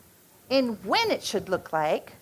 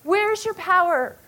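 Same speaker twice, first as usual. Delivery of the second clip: higher, pleading cry to God; anguished plea to God; 7 semitones higher, slower than usual. Retold or imitated. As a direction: ask God in desperate pleading. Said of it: "you're just going".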